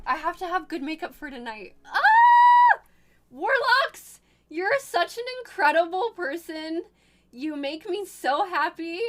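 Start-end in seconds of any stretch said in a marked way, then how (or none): 1.8-2.8 very high, loud squeal; delighted scream. Real, not acted. Streamer overwhelmed by a sweet picture, delighted scream. 3.3-9.0 high, loud heartfelt praise; gushing delight. Real, not acted. Streamer thanking Warlocks for the picture, gushing.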